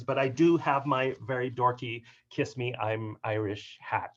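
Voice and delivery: ordinary delivery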